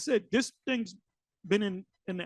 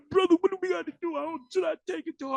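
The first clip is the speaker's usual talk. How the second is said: high, fast worried brother; imitating frightened brothers asking what to do; panicky plea; 10 semitones higher, much faster than usual. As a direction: rush it like a nervous believer.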